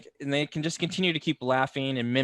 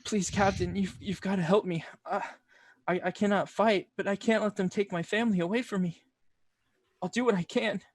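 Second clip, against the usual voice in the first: desperate begging; 6 semitones higher than usual. Acted. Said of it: "Carrie begs the captain".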